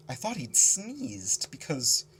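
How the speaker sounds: usual speaking voice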